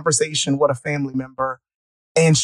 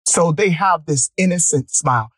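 normal talking voice

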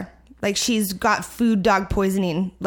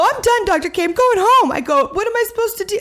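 high, loud, frantic yelling; panicked outburst at the vet; hysterical; 11 semitones higher, 4 dB louder than usual. Retold or imitated. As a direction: shout it, at the end of your rope. Hysterical call with her vet.

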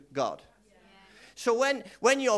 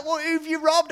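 high, loud, mock-shocked mother; astonished mum; 9 semitones higher, 6 dB louder than usual. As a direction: play the stunned mum catching her boy. Mum reacts to twelve baskets.

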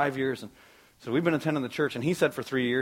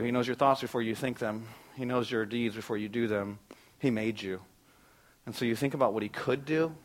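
ordinary talk

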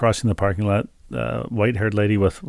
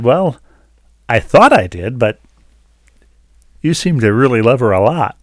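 louder, emphatic retold reply; retold protest; 2 semitones higher, 7 dB louder than usual. Husband answers the lady's challenge, loud retold protest. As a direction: answer back loudly, as retold.

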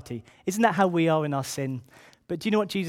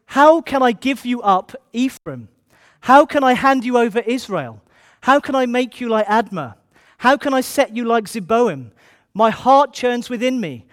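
impassioned plea; 5 semitones higher, 6 dB louder than usual. Acted. God pleading with Israel, Hosea.

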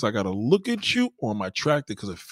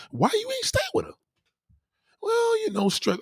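high mock questioning. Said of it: "voicing interview of ex".

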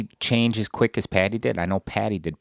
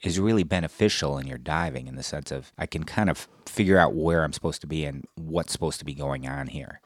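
ordinary talk

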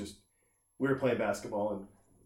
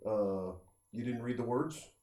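dismissive guy; 4 semitones lower, 10 dB quieter than usual. Retold or imitated. Clueless guy voiced in rant.

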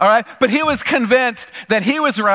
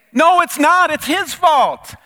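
straight-faced denial; 10 semitones higher, 6 dB louder, slower than usual. Retold or imitated. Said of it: "with a straight face".

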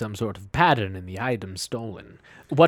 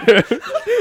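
high laugh; 9 dB louder than usual. Acted. Character bursts out laughing.

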